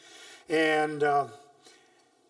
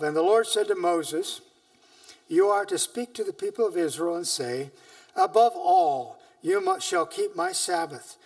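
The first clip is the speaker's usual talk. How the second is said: a slightly louder, measured reading of scripture; 4 dB louder than usual. Acted. Preacher reading Exodus to his church, solemn and measured. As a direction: read the Lord's words with weight to the church. Reads Exodus aloud to congregation.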